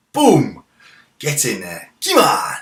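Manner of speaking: loud, high bursts of joy; excited replay cheer. Real, not acted. Gamer watching the goal replay, loud excited cheer. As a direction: relive the goal and roar it.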